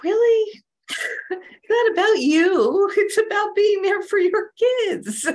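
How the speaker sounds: heated retold rebuke, close to crying; retold rage. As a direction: let the outrage burst out at the brother. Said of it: "the Kali in me was, like, coming out".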